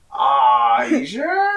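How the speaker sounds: high, laughing "you sure?"; half-crying with laughter at the rejection; mock-pained laugh through the words; laughing disbelief. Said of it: "And I'm like".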